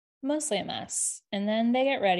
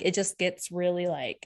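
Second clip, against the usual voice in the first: ordinary talk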